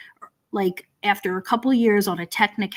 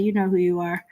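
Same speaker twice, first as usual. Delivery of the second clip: ordinary talk, nothing marked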